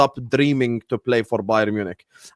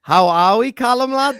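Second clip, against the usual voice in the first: louder, higher put-on Scouse accent; 6 semitones higher, 3 dB louder than usual. Shown as a demonstration. Showing off his Scouse to the panel in a contest, Scouse accent. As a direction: greet a mate in exaggerated Scouse. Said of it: "I'll speak Scouse for fifteen seconds".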